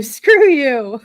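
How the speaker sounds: loud mock retort. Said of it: "I just loved his reaction to it too".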